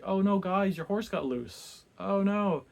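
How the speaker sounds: loud, high fake dismay; mock concern. The miller faking dismay at the students' horse. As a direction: ham up false sympathy.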